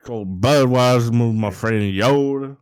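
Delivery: slow, hammed-up Cajun drawl; mock Cajun bayou man; Cajun accent. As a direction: play a swamp-dwelling Cajun, slowly. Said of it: "and he's Cajun".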